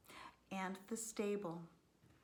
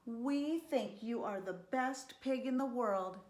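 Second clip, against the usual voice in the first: higher, louder storybook praise; fond praise; 5 semitones higher, 5 dB louder than usual. A narrator's reading. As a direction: praise the pig brightly and loudly. Farmer and wife praise pig.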